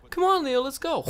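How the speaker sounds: very high, loud mock kid voice; excited kid imitation. Imitating his friend eager to ride the scooter, high excited squeal.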